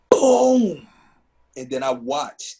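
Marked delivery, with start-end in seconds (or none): 0.1-0.9 a loud, high, sharp explosion sound; imitated explosion boom. Recalling the plane hitting the tower, a loud imitated boom. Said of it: "It was".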